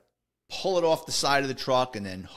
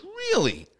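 imitated disbelief, pitched way up; incredulous. Boss hears detective's account of Vinny, mock incredulous. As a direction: toss it back as a high, doubting question.

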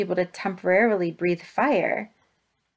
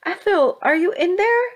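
high, loud, worried call rising at the end; anxious calling out; 11 semitones higher, 6 dB louder than usual. A narrator's reading. Grandma Sylvie searches for Ethel in the collapsed house, crying out. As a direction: call into the wreck for a friend. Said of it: "she cried".

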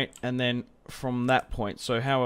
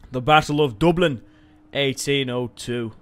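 mock Irish-Manchester accent; 4 dB louder than usual. Gamer announcing the battle to viewers, in a hammed-up Irish-Manchester accent. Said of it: "a shitty Irish sort of Manchester sort of fucking accent".

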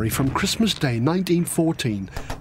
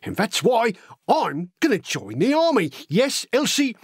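lively, wide-eyed working-class lad spinning war tales; excitable storytelling with big pitch leaps; eager young Cockney recruit; 4 semitones higher than usual. Acted. Horace telling Elsie gruesome war stories, eager and excitable.

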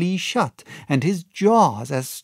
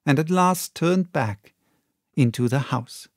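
plain reading voice

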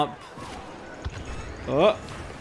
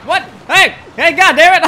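loud pained outburst, much higher; raging gamer; 11 semitones higher, 12 dB louder than usual. Real, not acted. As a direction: yell in pain and fury. Getting shot in game.

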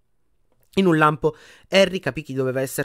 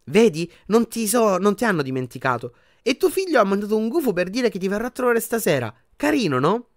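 higher, chirpy nurse voice; cheerful healer; 8 semitones higher than usual. A narrator's reading. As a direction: cheer up the patient brightly. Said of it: "disse allegra la guaritrice". (guaritrice allegra con voce acuta)

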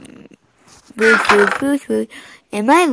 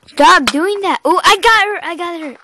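high, loud exclamation; excited fight outburst; 7 semitones higher, 6 dB louder than usual. Real, not acted. Gamer fighting another player, loud and excited. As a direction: call out sharply in the heat of play.

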